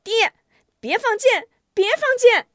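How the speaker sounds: very high, loud daughter's plea; shrieked plea in a high voice; desperate shout. A narrator's reading. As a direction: cry out to stop your father. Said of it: "大喊".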